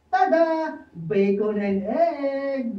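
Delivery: high, slow showman's reveal; proud reveal. Acted. Juan grandly reveals his sandwich to Pedro, high and slow. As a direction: unveil it grandly.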